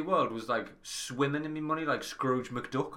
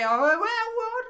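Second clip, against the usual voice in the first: shocked disbelief; 9 dB louder, much slower than usual. Retold or imitated. Reading the cancel email.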